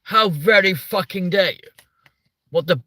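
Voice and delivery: hammed-up posh English outrage; mock posh voice. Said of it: "we now sound like the fucking Tory party".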